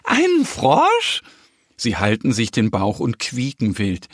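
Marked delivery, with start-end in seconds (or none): 0.0-1.2 very high, slow piglet squeal; mocking squealing piglets. A narrator's reading. Piglets laugh at the bear's question about a frog, squealing. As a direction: squeal it in amused disbelief. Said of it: "Die Schweinchen lachen".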